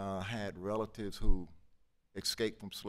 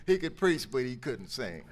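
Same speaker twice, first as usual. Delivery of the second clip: laughing; 7 semitones higher, 9 dB louder than usual. Real, not acted. Laughing at King's singing.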